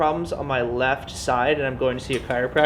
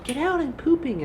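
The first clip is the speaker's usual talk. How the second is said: imitating wife; 11 semitones higher than usual. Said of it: "she was like".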